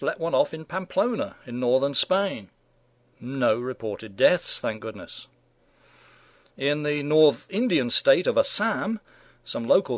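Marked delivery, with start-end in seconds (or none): 4.6-5.3 softer and lower, a quiet genuine thanks; hushed sincere thank goodness; sincere relief. Real, not acted. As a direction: breathe it out with real relief. Relief after no reported deaths.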